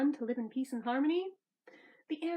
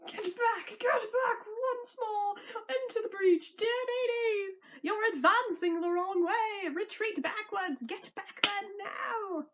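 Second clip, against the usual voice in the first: higher barking general voice; lightly voiced bossy villain; commanding villain; 7 semitones higher than usual. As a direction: order the troops back. Meanie ordering troops.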